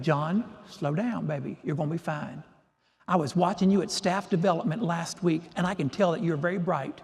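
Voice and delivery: calm them gently with a Southern lilt; genteel Southern voice. Retold or imitated. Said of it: "this lovely Southern genteel voice".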